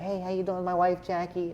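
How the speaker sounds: high, badly faked comedian voice; Adam Sandler. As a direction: do a terrible celebrity impression. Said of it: "That's my terrible Adam Sandler impression".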